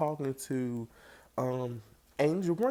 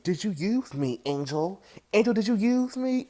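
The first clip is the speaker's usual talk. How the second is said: mock accusation; 7 semitones higher, 5 dB louder than usual. [name] confronting Angel angrily.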